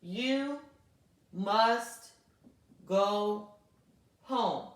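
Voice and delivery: each word spaced out slowly; slow classroom dictation, word by word. Shown as a demonstration. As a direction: dictate it one word at a time. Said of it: "capital Y".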